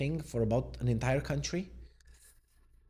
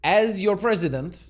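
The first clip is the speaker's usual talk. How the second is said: loud, higher, proclaimed like a stump speech; mock presidential; 7 semitones higher, 7 dB louder than usual. Vlogger reading a Trump quote aloud, mock presidential. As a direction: declare it grandly, pitched up. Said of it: "I should do an impersonation".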